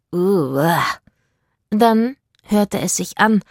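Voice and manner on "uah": sailor's "ugh" of disgust; disgusted groan. A narrator's reading. Sailor recoils from the parrot's sweet talk, disgusted groan. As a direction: groan as if revolted. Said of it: "einen angewiderten Laut".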